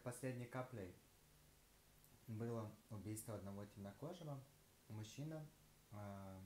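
talking between bites; slightly muffled chewing speech; mouth partly full, chewing. Real, not acted. Seen 0: Bite taken near -2s; chewing motions between words up to 4s.

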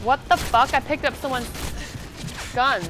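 surprised outburst. Real, not acted. Surprised by wrong gun.

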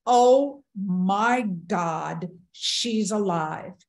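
retold shocked cry, drawn out. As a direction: stretch out a stunned exclamation. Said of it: "I hear the man cry out".

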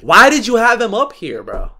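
ordinary talk, nothing marked

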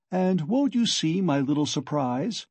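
plain reading voice

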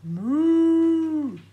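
long, loud, high lowing moo; playful moo for children; cow noise. Shown as a demonstration. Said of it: "It's a cow".

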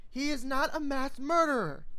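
high loud protest; insist, offended; high indignant young mare. Acted. Filly protests.

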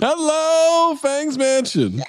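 high, slow arena rock holler; rock concert shout. Retold or imitated. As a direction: stretch it out like a hair metal singer. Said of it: "like a [name] concert".

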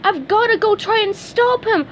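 high-pitched, urgent cartoon voice; high, frantic character voice; SpongeBob crying out. A narrator's reading. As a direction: call it out high, in alarm. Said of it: "SpongeBob cried, chasing after his friend".

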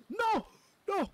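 loud frustrated shout. Real, not acted. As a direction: yell it out in frustration. Losing the mango seed again.

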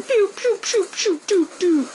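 high pew pew gun noises; mock gunshots. Gamer acting out his sniper shots for viewers, mock gunfire. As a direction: fire off playful pew sounds.

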